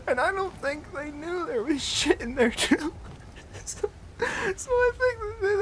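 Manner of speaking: high-pitched, squeaking through real laughter; helpless laughter. Real, not acted. Teller recalling teacher cleaning his mess, helplessly laughing. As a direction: struggle to get it out while cracking up.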